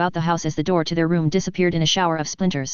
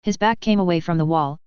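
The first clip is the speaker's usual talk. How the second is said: ordinary delivery, nothing marked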